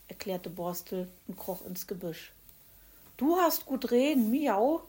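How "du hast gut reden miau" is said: grumpy. Acted. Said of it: "entgegnete der Kater mürrisch".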